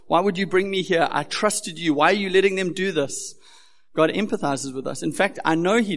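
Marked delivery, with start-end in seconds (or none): 0.1-3.3 loud, higher anguished plea; a pleading believer shouting up to God; crying out to God. Retold or imitated. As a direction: cry out in protest to God. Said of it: "as we kind of cry out to Him".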